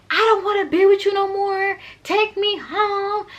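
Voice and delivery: mocking her boyfriend's loud whining. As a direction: mimic him high and petty, dragging "Take me home". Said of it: "he starts getting loud".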